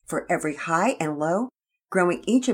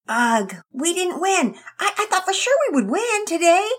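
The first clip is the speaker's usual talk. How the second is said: put-on sad girl; 8 dB louder than usual. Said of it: "Penelope said sadly".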